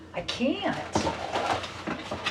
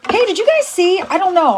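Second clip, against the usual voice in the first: excited greeting; 11 semitones higher, 5 dB louder, faster than usual. Real, not acted. Greeting viewers after a break.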